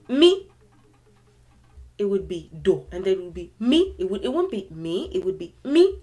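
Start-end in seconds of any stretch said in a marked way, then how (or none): 0.1-0.5 "me." sung out on a high, loud tone; high tone demo. Shown as a demonstration. Teacher showing learners the tone on "me.", high and loud. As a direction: lift "me." up high and hit it hard.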